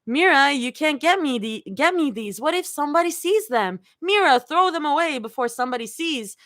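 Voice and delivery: mocking imitation. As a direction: mimic her mockingly. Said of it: "mimics Rumi's voice and mocks".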